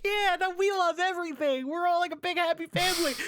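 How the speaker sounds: sing-song cheerful mockery; mock cheerful fan voice. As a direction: parody a happy crowd. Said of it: "they're like".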